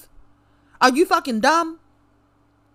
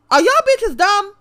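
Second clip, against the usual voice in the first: louder, higher repeat of the question; outraged yell; 8 semitones higher, 3 dB louder than usual. Real, not acted. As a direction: hit it again, much higher and louder.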